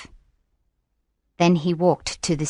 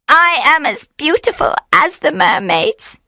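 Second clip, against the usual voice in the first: seductive witch; 8 semitones higher than usual. A narrator's reading.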